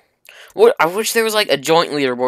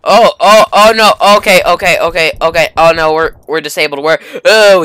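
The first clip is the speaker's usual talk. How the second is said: loud panicked cries; yelling in alarm as vehicle is hit; 3 semitones higher, 10 dB louder than usual. Real, not acted.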